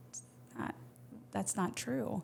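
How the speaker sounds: hushed, gentle voice for a retold comfort; tender correction. Jesus meeting a hurting person, tenderly correcting. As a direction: drop soft and gently set them straight. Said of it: "so tender to meet us and say".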